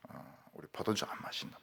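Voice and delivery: soft unvoiced whisper; whispered firm refusal; hushed vow. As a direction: breathe the vow quietly. Voicing the Rechabites' vow.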